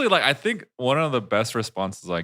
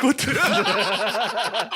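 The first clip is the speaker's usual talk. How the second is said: laughing; 13 semitones higher than usual. Retold or imitated. His stunned reaction.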